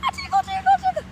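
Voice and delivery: high squeaky sing-song tickle. Pretend. Tickling the viewer with the air claw, squeaky playful tickle. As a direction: tease like tickling a child.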